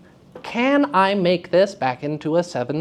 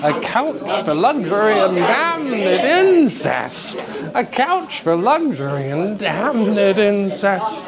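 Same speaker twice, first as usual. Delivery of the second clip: slow, drawn A couch for luxury and damned incest; savoring the line; 3 semitones higher, slower than usual. Shown as a demonstration. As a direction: linger on each word. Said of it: "have fun with it".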